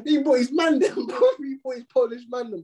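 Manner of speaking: words squeezed out through high laughter; can barely get it out for laughing; cracking up mid-story, pitch jumping up. Real, not acted. Laughing while retelling the chase.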